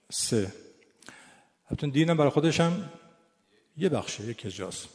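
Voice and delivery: ordinary delivery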